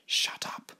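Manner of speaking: whispered inner thought; soft, voiceless. Acted. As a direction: whisper it as a silent plea. Abdul's silent urgent thought.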